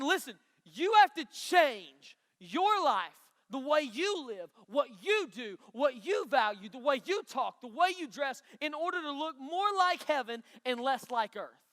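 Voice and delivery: slow, loud, insistent voicing of Paul; loud and slow sermon appeal; emphatic preaching. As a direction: drive each phrase home. Preacher voicing Paul's charge.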